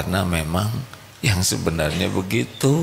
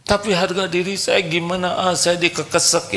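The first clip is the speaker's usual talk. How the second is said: higher, whining about his pride; whiny husband; 12 semitones higher than usual. Acted. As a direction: complain like a wounded ego. (suami merengek soal harga diri)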